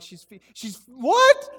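loud, high, drawn-out disbelief; hammed-up stunned outburst; acted shock. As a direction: blurt it out in scandalised astonishment. Acts shock at wife's revelation.

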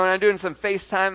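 ordinary talk, nothing marked

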